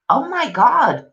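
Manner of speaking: ordinary talk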